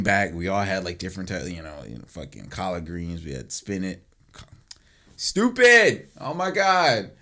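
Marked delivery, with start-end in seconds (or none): 5.2-7.1 high, loud burst of exasperation; loud, exasperated self-rebuke; self-scolding. Real, not acted. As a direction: exclaim it at your own slip. Scolds self for spinach slip.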